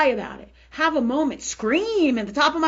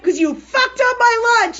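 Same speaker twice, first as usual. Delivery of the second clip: high, slow, loud re-enacted accusation; mock raging; 10 semitones higher, 8 dB louder than usual. Re-enacting her lunch rage at her sister, mock furious. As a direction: draw out the pretend outrage at full volume. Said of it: "yesterday I had a raging moment".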